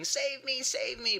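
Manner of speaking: high mock screams of children; screaming children. Children at the window calling to the fireman, mock screaming. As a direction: squeal like trapped kids.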